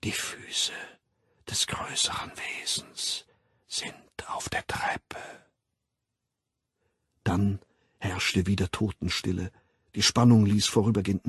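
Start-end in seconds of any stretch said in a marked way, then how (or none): 0.0-5.2 barely audible voiceless whisper; dying whisper. A narrator's reading. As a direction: whisper it almost without sound, pausing between phrases. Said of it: "Das Flüstern erstarb fast".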